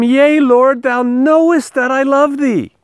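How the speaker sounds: high, earnest plea of a hurt disciple; Peter's pleading reply. Acted. Peter answers Jesus, scripture read.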